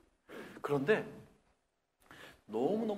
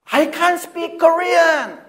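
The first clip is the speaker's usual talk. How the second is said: high, loud, drawn-out English retort; student brushing off the teacher; defiant teen; 13 semitones higher, 18 dB louder, much slower than usual. Retold or imitated. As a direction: throw it back defiantly in English. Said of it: "무슨 말을 하는지 아십니까".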